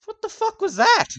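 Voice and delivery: high, loud, indignant yell; shrieking at the newcomer who spilled his beer; angry human's outburst. Acted. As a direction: snap at the clumsy stranger. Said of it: "uh, he goes".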